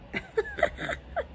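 breathy high giggle. Real, not acted. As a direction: giggle until you can't speak. Laughs, can't talk.

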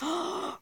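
sharp high intake of breath; excited gasp. Real, not acted. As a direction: gasp at a sudden idea. Spots a hat option in game.

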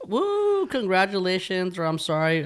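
high, loud congratulatory whoop; whoop, then keep the voice up, teasing; celebratory cheer. Real, not acted. Co-host cheering a friend's engagement, celebratory.

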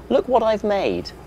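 high, otherworldly little robot. Acted. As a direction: play Hubble as a proud robot. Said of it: "who said".

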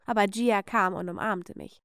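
usual speaking voice

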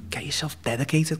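hushed, intense whisper of advice; whispered coaching. Retold or imitated. Coach urging him in a whisper. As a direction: whisper it like a secret push.

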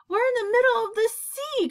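very high, astonished cry; cried out. A narrator's reading. James to the others on the peach, astonished cry. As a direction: call out the discovery high. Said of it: "cried James".

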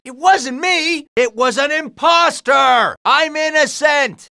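high, slow wail of innocence; high indignant protest; protesting shout. Acted. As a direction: shout your innocence, stretching the words.

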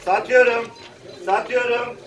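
calling out bids at auction; rhythmic auction calling; auctioneer. Real, not acted.

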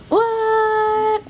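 high put-on silly voice, drawn out; high, whiny pretend objection; playful mock protest. Mother jokes about baby's camera, playful high protest.

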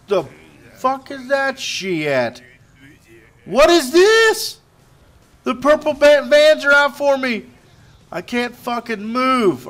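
high, loud and slowed disbelief; shocked outburst. Real, not acted. Gamer shouts at vans blocking his car, shocked. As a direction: blurt it out, stunned and annoyed.

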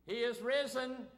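loud, high proclamation; call to the congregation. Shown as a demonstration. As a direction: shout it out to the whole church. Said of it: "your part is to say".

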